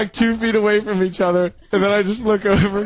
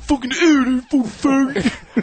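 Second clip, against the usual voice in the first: mock disgusted stranger, pitched up, drawn out; hammed-up disgust; 6 semitones higher, slower than usual. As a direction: play a grossed-out stranger at the urinal. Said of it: "just to see them be like".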